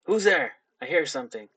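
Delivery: a lightly voiced gruff figure calling out from a shed; startled call of who's there, then a suspicious mutter. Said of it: "And it said, ... it's a deeper voice".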